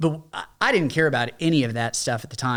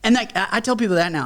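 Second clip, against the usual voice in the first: usual speaking voice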